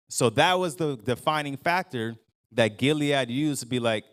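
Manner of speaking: ordinary talk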